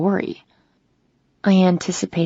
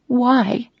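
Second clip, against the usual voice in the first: voice breaking; 6 semitones higher than usual. Acted. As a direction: voice cracking on a pained question. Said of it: "My voice breaks".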